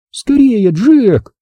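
high, loud worried call upward; nervous shout. A narrator's reading. Mia shouting up to Jack in the tree, nervous. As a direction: urge him on anxiously. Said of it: "нервно закричала она".

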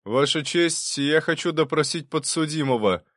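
loud announcement. A narrator's reading. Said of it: "Прокудин громко объявил". (громкое объявление, слегка приподнятый голос)

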